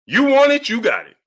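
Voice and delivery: high you want it, then settling; mock fighter's challenge. Streamer acts out the fighter's defiant challenge. Quoting Rocky's line.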